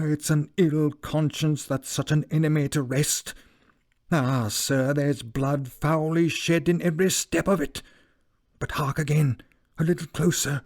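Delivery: an uneasy butler urging his master to listen; frightened old butler. A narrator's reading. Poole the butler speaks in fear.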